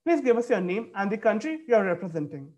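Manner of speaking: ordinary talk, nothing marked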